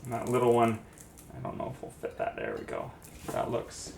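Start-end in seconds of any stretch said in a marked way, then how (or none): 1.4-3.9 hushed whisper while concentrating. Real, not acted. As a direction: whisper it under your breath. Fitting the last piece in.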